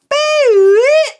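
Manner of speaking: shrill electronic beep, very loud; loud high beep. Retold or imitated. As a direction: blast a high beep like a buzzer. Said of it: "And then I go".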